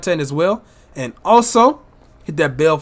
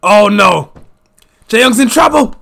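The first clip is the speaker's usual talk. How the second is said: excited live play-by-play calling; wrestling commentator; 5 dB louder than usual. Acted. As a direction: call each move as it lands.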